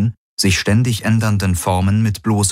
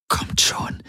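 soft, voiceless urging; hushed whisper; 6 dB quieter than usual. A narrator's reading. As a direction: whisper it like a private plea. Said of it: "hörte er Kirai flüstern".

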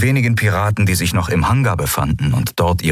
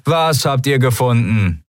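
higher, mildly irritated shout back; annoyed call; 6 semitones higher than usual. A narrator's reading. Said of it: "rief jemand genervt". (genervtes Zurückrufen)

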